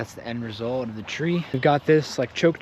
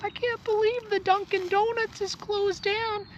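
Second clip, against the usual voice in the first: put-on Pennsylvania accent; 4 dB quieter than usual. Imitating Shamokin locals upset the Dunkin' closed, high mock Pennsylvania accent. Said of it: "What's a P A accent?".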